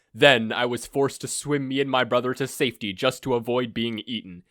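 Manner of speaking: grandiose villain. Acted. Villain gloats to his blindfolded captive, pompous and loud. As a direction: boom out a self-important evil plan.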